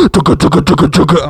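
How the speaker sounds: loud vocal drumbeat; imitated drums. Imitating the punk jukebox drums, loud. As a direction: beat out the drums with your mouth. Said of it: "And it's like".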